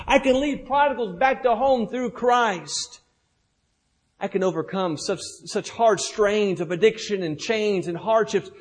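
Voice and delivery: loud, high, fast rallying cry; full-force preaching. Acted. Pastor rallying the congregation, loud impassioned preaching. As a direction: preach it with everything you have.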